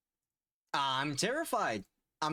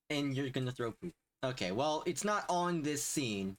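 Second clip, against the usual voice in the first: ordinary talk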